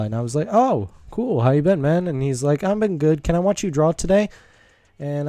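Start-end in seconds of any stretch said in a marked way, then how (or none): 0.5-2.1 friendly greeting. Retold or imitated. Retold friendly greeting to the kid. 2.6-4.3 higher, louder imitation of a keen child; eager kid. Kid asking to watch him draw today, eager.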